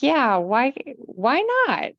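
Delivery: high-pitched, acted-out "why not"; retold self-encouragement. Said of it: "and I'm like".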